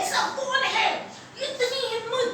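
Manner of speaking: high-pitched outraged demand; indignant lion. Acted. Lion king hears of the rival's nerve, indignant. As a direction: challenge the unseen rival, pitch way up.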